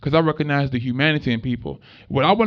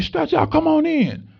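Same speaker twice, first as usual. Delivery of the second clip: welcoming doorman; 12 semitones higher than usual. Retold or imitated. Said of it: "and they say".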